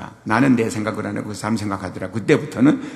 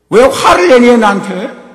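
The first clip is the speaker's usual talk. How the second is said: sharp, much louder and higher, indignant; accusing shout; 12 semitones higher, 11 dB louder than usual. Retold or imitated. Caller snapping at the customer, loud and offended. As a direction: fire back the accusation hard.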